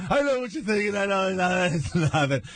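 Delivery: high, laughing mock apology; sheepish laugh. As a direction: admit it's nothing while giggling. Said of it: "And, and I'm going".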